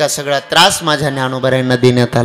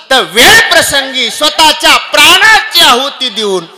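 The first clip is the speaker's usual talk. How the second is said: higher, louder kirtan preaching; preaching at full force; 11 semitones higher, 6 dB louder than usual. Acted. Kirtankar rousing the audience with the story, fervent preaching. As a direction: drive the story home to the crowd.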